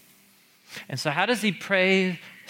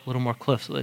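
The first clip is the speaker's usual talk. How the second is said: normal talking voice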